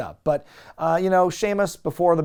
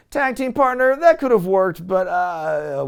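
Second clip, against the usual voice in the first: mock Ricky mumble; 4 semitones higher, 5 dB louder, slower than usual. Mimicking mumbled Ricky Hatton lines.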